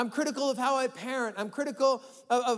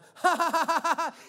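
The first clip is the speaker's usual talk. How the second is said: dismissive laugh; 6 semitones higher, 3 dB louder than usual. Retold or imitated. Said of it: "a defense mechanism".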